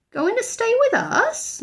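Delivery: high, shocked echo; aghast housekeeper. A narrator's reading. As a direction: repeat it in disbelief. Mrs Bird aghast.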